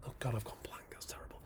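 very soft, muttered self-reproach; whispered aside. Real, not acted. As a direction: whisper it to yourself after losing your thread. Loses thread, mutters to self.

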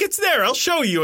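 high, chirpy mock insistence; eager mock reassurance. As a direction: mimic your co-host's confidence. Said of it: "And you're like".

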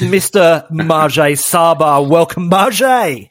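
slow, drawn-out guest intro; announcer voice. Acted. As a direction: announce him like a game-show guest. Host announcing special guest.

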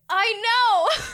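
high, loud gushing; excited agreement. Real, not acted. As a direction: burst out in delighted agreement.

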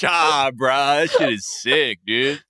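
lightly done stoked SoCal skater dude; a hint of laid-back skater bro slang; Gigi Hadid skater dude voice. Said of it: "has, like, a skater dude voice".

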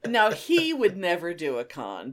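usual speaking voice

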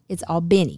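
"Albany" said al-BIN-ee, Georgia style; the Georgia way of saying "Albany"; Southern Albany. Shown as a demonstration. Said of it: "Albany is in New York".

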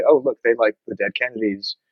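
ordinary talk, nothing marked

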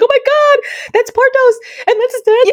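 gushing with delight at the screen; excited gush. Retold or imitated. Excited reaction to commercial.

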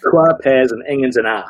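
put-on Dundonian accent saying twa pears; Dundee accent. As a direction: mimic a thick Dundee local. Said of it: "very strong Dundonian accent".

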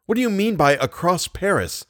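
high-pitched, distressed questioning; lightly hoarse, dismayed question. A narrator's reading. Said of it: "asked poor Raoul in a hoarse voice".

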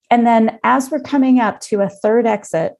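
ordinary talk, nothing marked

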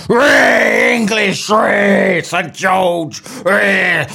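mock drunken bloke yelling his patriotism; drunk English patriot. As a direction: roar it like a hammered lad draped in the Saint George's cross. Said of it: "get really hammered down the pub, like proper".

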